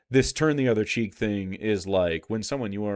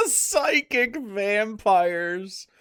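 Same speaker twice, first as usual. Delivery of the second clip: slow, stretched, higher mocking repeat; incredulous echo; 10 semitones higher, 3 dB louder, much slower than usual. Real, not acted. Repeating the co-host's reading back to him, slow incredulous mockery. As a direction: drag each word out in amused disbelief. Echoes the phrase in disbelief.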